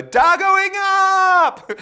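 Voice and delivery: slow, very high drawn-out shout; sing-song shout. Retold or imitated. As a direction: stretch it out like a makeup artist calling to the set. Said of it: "would go".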